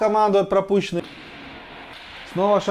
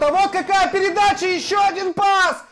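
excited; 11 semitones higher, 3 dB louder than usual. Real, not acted. Admiring a great pass.

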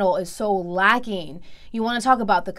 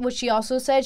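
ordinary delivery

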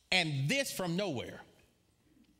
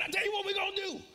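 very high-pitched retort; re-enacted outburst; much faster than usual. Preacher repeating for the congregation exactly how he answered, high-pitched outburst. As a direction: replay the sudden outburst far above your usual voice. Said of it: "That's exactly how I said it".

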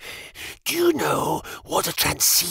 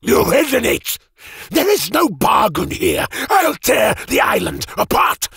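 furious. Acted. Mage grips Jim's throat and shouts in fury. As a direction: tear into him with rage. Node throttles Jim, raging.

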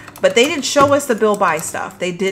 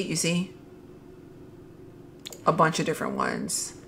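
ordinary talk, nothing marked

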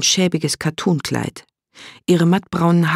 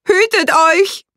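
much higher, louder, slow drawn-out scream; final shriek; 6 dB louder, slower than usual. A narrator's reading. Automaton woman shrieks her warning a final time, high and slow. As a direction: scream it out one last time. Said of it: "kreischte die Frau ein letztes Mal".

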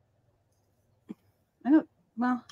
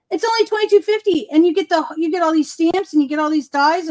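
very high, louder, fast eager pitch; mock sales pitch; 6 semitones higher, 4 dB louder, faster than usual. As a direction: rattle off the deal like a pushy saleswoman. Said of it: "And I'd be like".

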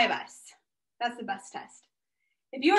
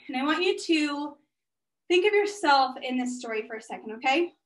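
ordinary talk, nothing marked

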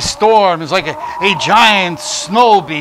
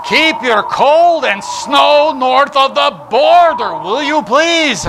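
loud, high, slow plea; mock plea; 9 semitones higher, 6 dB louder, slower than usual. Host begs Canadian viewers to keep their snow, loud slow plea. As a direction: beg them to keep the snow north.

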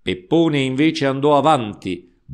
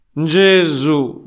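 a louder, higher dismayed cry; 5 semitones higher, 7 dB louder than usual. Acted. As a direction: call out in consternation.

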